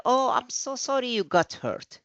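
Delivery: high-pitched motherly sympathy; sympathetic mom. Acted. Mom comforting her child who got hurt, sympathetic. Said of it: "your mom would say".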